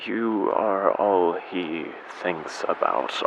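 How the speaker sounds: slow, soft brooding; bitter confession. Acted. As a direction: dwell softly on the torment. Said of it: "confess my bitter feelings".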